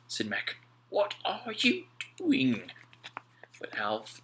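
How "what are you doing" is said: much higher, slow worried question; Mac, worried. A narrator's reading. Mac to Alf at the trunk, slow high worried question. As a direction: ask in disbelief.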